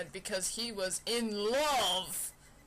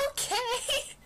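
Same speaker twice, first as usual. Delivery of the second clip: put-on girly okay; 13 semitones higher, 3 dB louder than usual. [name] in high girly voice.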